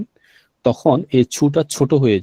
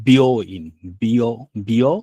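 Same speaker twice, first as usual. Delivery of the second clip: ordinary delivery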